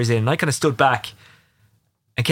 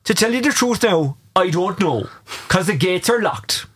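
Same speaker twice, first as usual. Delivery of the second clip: imitating a Kerry accent, higher and slow; 6 semitones higher, slower than usual. Retelling the Kerry analyst's reply about the locked gates, in a Kerry accent. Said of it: "I won't do the accent justice".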